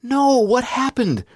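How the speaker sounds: very high, loud, horrified cry; a sister hearing her brother's son is dead; screaming in shock. Retold or imitated. Sister hearing the terrible news on the phone, screaming in shock. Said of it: "She screamed".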